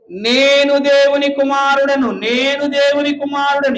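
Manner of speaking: slow, deliberate recitation of the verse; measured scripture recitation; solemn scripture reading. Acted. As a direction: read the verse out slowly, word by word. Preacher quotes scripture.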